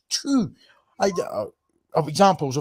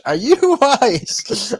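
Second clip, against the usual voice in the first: loud and slow, laughing through it; are you kidding me, laughing; mock outrage; 8 semitones higher, 7 dB louder, much slower than usual. Mock outrage at being called posh.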